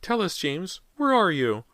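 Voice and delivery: high, loud terrified calling; frantic shriek. A narrator's reading. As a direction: scream the question at the room.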